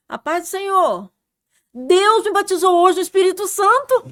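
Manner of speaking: loud, high and jubilant, greeting everyone; loud happy proclaiming to passers-by; joyful exclamation. Retold or imitated. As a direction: call it out bursting with joy. Greeting passers-by, newly baptized joy.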